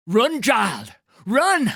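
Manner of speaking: high, loud desperate yell; frantic shout. Acted. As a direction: scream at the child to flee. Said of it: "the old man shouted".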